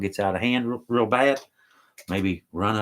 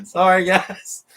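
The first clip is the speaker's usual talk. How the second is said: laughing; 8 semitones higher, 7 dB louder, slower than usual. Real, not acted. Laughing fit on a livestream.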